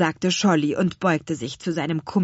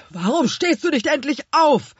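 loud, raised, angry question; barking; 9 semitones higher, 5 dB louder than usual. A narrator's reading. Herr Kümmerling yells at fallen Ralle, barking. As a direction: snap impatiently. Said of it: "blaffte Herr Kümmerling".

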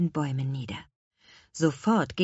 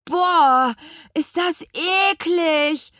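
high, drawn-out yell of disgust; 10 semitones higher, 5 dB louder, much slower than usual. A narrator's reading. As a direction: bellow how gross it is. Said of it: "brüllte auch Louis". (angeekelt gebrüllt)

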